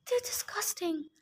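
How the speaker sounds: hushed, repulsed verdict; disgusted whisper. Acted. Annabeth repelled by the stables.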